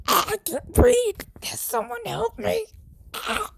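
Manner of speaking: high, strained voice of a man being choked; mock choking plea for help; strangled doctor. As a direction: gasp the words out as if choking.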